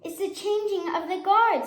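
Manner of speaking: high excited announcement; proud tour-guide lion. A narrator's reading.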